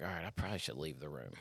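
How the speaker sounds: low, very soft thinking aloud; wary aside. Retold or imitated. Vet alone with an angry monkey thinks of leaving, hushed wary aside.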